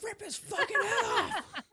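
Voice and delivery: very high laughing echo of a yell; mock yell. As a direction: squeal it back through laughter. Echoes the yell while laughing.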